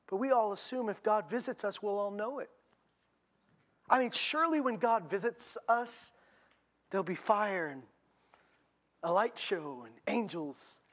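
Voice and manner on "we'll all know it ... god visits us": ordinary delivery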